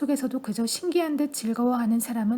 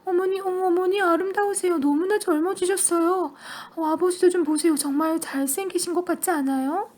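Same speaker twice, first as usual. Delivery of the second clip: much higher, louder gushing praise; delighted shout; 6 semitones higher, 3 dB louder than usual. A narrator's reading. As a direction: squeal with delight. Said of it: "소리쳤다".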